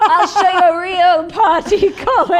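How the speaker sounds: hammed-up boast; playful shout. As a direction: yell it across the room to Colin. Shouting pitch to Colin Jost.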